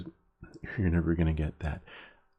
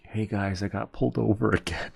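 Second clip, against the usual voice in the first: louder, amused update breaking into a laugh; mock repeat confession; 3 semitones higher, 4 dB louder than usual. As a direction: play the hopeless repeat offender, about to crack up.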